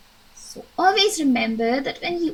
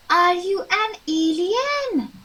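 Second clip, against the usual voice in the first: higher, slow astonished ask; surprised question; 5 semitones higher than usual. Shown as a demonstration. Teacher acting a startled girl for the class, higher and slow. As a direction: ask it as if you can't believe your eyes. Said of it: "angry or surprised".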